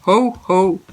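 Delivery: low, drawn-out ho-ho-ho; jokey Father Christmas chuckle; Santa laugh. Pretend. (petit rire de Père Noël)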